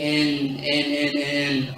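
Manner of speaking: each "N" stretched slowly on one note; drawn-out letters. Shown as a demonstration. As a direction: drag out every "N" on the way to "G". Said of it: "they expand the word".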